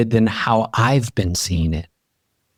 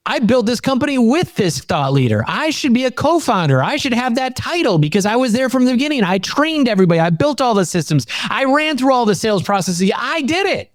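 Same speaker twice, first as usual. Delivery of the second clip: high, intense, emphatic; high ardent self-assertion; impassioned claim; 12 semitones higher than usual. Real, not acted. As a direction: claim your due with fire. Passionate claim of achievements.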